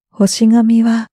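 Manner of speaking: usual speaking voice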